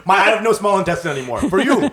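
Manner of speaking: talking through laughter; laughing. Real, not acted. Guest riffs to auntie about losing his colon, laughing. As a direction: let the laugh run under the joke. Friend joking, laughing as he talks.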